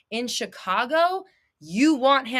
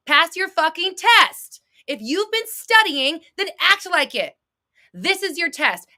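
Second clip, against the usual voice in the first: louder, emphatic pep talk; fired-up coach rallying the viewers; heated push; 7 semitones higher, 5 dB louder than usual. Real, not acted. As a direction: drive them hard to act.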